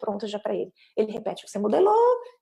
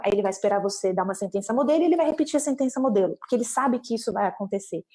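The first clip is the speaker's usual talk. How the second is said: normal talking voice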